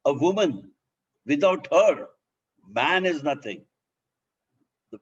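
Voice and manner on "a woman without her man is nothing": "her" louder with pauses around it; stress on her. Shown as a demonstration. Trainer showing the woman-first meaning with a louder "her". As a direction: lean hard on "her" so the woman comes first. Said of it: "if I write like this".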